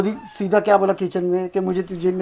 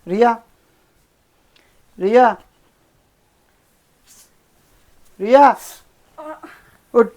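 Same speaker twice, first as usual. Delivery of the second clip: calls a name, louder each time; calling out, building; 5 semitones higher, 7 dB louder than usual. Acted. Husband waking his wife, calling her name ever louder. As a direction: wake her from across the room, rising call.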